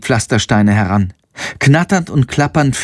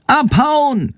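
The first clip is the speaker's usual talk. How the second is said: high shrill bat cry; shrieking bat. Acted. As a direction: screech it like a bat. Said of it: "Schandors kreischende Stimme".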